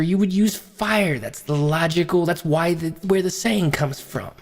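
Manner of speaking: usual speaking voice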